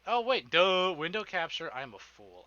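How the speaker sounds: ordinary delivery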